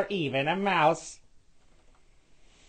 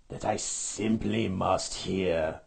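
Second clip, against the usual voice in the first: grinning Grinch; 6 semitones lower, slower than usual. A narrator's reading. The Grinch grins at the coming cries, low and slow. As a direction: savor each word like a villain. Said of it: "grinned the Grinch".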